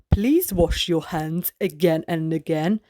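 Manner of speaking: a hint of a parent repeating the rule; grown-up voice. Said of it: "So if you hear a grown-up say".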